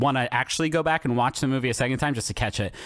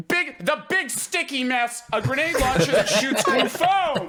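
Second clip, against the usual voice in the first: extremely nervous vendor; 9 semitones higher than usual. Retold or imitated. Vendor pitching a glue gun in seven seconds, nervously imitated. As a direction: imitate the vendor, pushing up to the last word. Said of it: "is a man who is extremely nervous".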